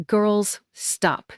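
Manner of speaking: plain reading voice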